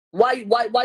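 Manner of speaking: loud, sharp rising why; angry loud demand. Real, not acted. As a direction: hammer the chat with the question. Ranting at viewers about Kelsey.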